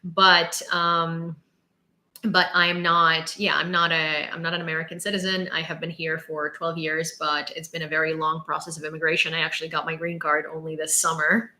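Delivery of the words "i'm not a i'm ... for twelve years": ordinary talk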